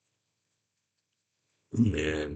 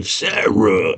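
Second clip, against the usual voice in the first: big beast calling out a name; put-on creature call; Ludo. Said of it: "So she hears Ludo going".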